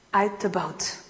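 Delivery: ordinary delivery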